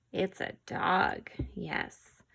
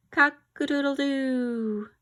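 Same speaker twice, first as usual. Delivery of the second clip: high crowing cock-a-doodle-doo; sing-song rooster call; 5 semitones higher than usual. Shown as a demonstration. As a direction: crow it out like a proud rooster. Rooster verse ends with crow.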